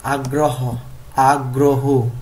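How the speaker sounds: আগ্রহ said carefully as ag-ro-ho; pronunciation drill. Shown as a demonstration. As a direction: repeat আগ্রহ firmly as a classroom model. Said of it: "উচ্চারণ করতে হবে এইভাবে".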